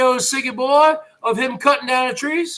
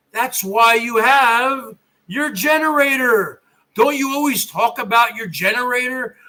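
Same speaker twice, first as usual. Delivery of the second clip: normal talking voice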